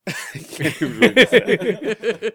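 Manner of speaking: giggling through the words; laughing. Real, not acted. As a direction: laugh while reading it out. Laughing at text.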